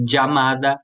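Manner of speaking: chamada said dja-mada, ll as D plus J. Shown as a demonstration. Teacher demoing the dj ll for students. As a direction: start chamada with a dj sound. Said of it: "como se fosse um D e um J".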